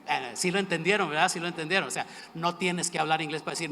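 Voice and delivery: ordinary delivery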